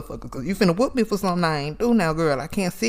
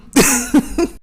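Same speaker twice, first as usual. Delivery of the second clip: bright burst of laughter; real laugh; 9 semitones higher, 6 dB louder than usual. Real, not acted. Comic laughing at his own setup, laughing. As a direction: laugh at the memory.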